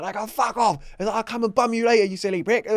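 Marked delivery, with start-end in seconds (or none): 0.1-0.8 much higher, loud imitated shout; mock aggressive yell. Sonny telling off the geezer. 1.2-2.7 much higher, loud imitated shout; mock aggressive yell. Sonny taunting the Russian geezer.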